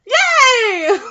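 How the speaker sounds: high, hammed-up squeal of joy; delighted cheer. Acted delight as the heroine.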